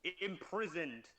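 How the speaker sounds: ordinary delivery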